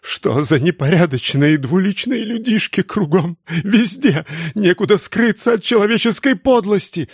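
high, tearful outcry; sobbing outburst. A narrator's reading. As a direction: break into sobs of outrage. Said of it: "разразилась рыданиями". (рыдающий крик, высоко)

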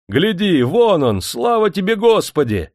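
high cry of relief; full-throated joyful shout. A narrator's reading. Man in the crowd spotting the rescued master, full-throated shout. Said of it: "голосисто закричал кто-то среди мужиков".

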